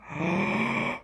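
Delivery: big, high gasp of delight; excited gasp. Real, not acted. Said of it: "Sorry, I'm getting excited now".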